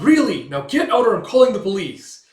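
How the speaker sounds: angry shout. Retold or imitated. Owner yelling at intruders to leave, furious. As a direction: bark them out of the house, hammed-up. Retold angry eviction threat.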